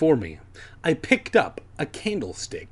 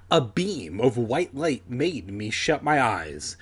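ordinary talk

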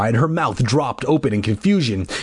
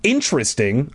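usual speaking voice

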